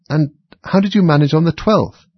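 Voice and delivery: high, loud keen question; eager. A narrator's reading. Alice presses the Mock Turtle about the twelfth day, eagerly. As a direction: press on with lively curiosity. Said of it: "Alice went on eagerly".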